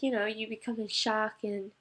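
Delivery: ordinary talk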